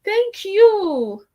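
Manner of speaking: high, loud grateful gush; imitating a touched client; touched delight. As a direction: gush with surprised thanks. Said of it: "I just be like".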